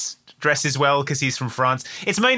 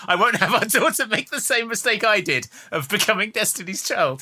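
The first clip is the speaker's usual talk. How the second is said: high mum voice; 8 semitones higher than usual. Acted.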